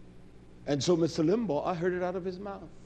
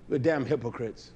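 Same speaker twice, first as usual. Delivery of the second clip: ordinary talk, nothing marked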